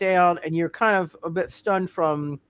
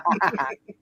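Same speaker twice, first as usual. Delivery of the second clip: chuckle. Real, not acted. Game master amused by a two ball special, chuckling. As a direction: chuckle briefly at a joke. Laughs at the special move joke.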